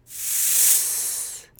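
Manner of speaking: long, soft "Sss" like a snake; snake hiss. Shown as a demonstration. Teacher imitates a snake for learners, long hiss. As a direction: hiss like a snake, drawn out and voiceless. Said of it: "sound that a snake makes when it hisses".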